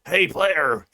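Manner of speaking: put-on brash American military accent; gruff American soldier. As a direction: play a big American army lad. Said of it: "played by an American in the most U S military".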